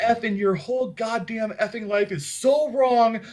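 half-acted furious rant, voice way up; mock sister yelling. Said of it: "my sister started yelling at me".

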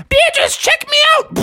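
high-pitched gleeful call; excited frog boast; showing off. Acted. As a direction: call out proudly to be watched. Froggy shows off on motorcycle.

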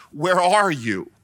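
ordinary talk, nothing marked

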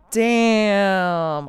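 a sudden loud "damn", caught off guard; a mock gasp of disbelief; stunned reaction. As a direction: blurt it out in shock. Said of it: "I was just like".

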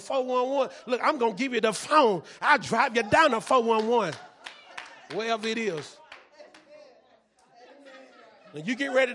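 put-on loud, heated scolding of a child; angry parent. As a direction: threaten the kid like a fed-up parent. Parent scolding a defiant kid.

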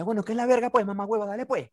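high, cocky taunt; mock challenge. Drunk Chemi daring the boyfriend to fight, high taunt. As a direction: pick the fight, swaggering.

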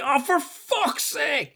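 high, drawn-out exasperated groan of words; retold outburst of dismay, high and slow. Retells walking into wet bathroom.

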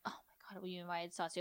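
breathy mock whisper. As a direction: hush it like a shocked secret. Mocking the gossip in whisper.

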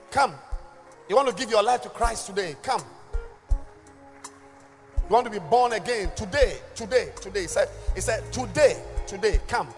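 high, loud booming altar call; booming motivational speech. Acted. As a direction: call them up with urgency. Altar call over the music.

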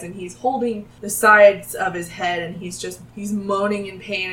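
ordinary delivery

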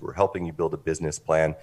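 ordinary talk, nothing marked

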